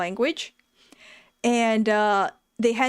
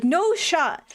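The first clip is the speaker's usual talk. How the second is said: high, insistent mock refusal; retold protest, pitched up; imitated alarm; 7 semitones higher than usual. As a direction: act out a worried owner refusing.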